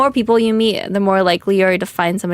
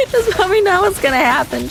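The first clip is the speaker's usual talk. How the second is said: high, laughing repeat; cracking up; 10 semitones higher, faster than usual. Real, not acted. Streamer tells chat it won't go well, cracking up. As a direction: say it through real laughter, pitch way up.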